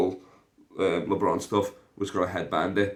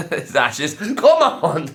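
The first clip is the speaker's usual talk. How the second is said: high, loud shout through laughter; laughing shout; 9 semitones higher, 11 dB louder, much slower than usual. Real, not acted. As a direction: cackle then yell it out. Joking about granddad's ashes celebration.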